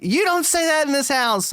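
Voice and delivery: high put-on scolding mother; scolding mom voice. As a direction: play the shocked mom laying down the rule. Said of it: "the mom's like".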